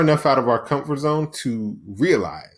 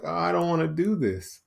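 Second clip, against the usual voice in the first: whiny; 5 semitones higher, 4 dB quieter than usual. Acted. Inner voice balking at a hard workout, whiny. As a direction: whine it like a reluctant inner voice. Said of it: "that whiny voice that I always talk about".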